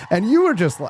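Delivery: usual speaking voice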